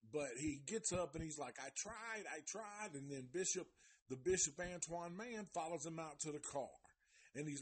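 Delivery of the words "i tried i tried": high, mock whimper; bitch mode. Reviewer retelling Mike getting up to leave, bitch mode. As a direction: imitate a whiner, pitched up. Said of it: "goes into bitch mode".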